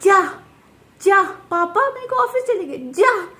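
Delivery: imitating the toddler saying go away; a mother playing her little son; child's voice. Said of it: "कैसे बोल रहा था, नहीं वो?".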